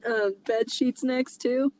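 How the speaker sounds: usual speaking voice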